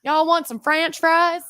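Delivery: high, slow country twang; exaggerated country drawl, badly faked; bad country accent. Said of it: "with a really bad country accent".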